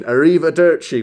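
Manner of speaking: put-on Italian word in an American accent; mock a bad American-accented Italian; loud, clumsy American pronunciation. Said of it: "with a fucking American accent".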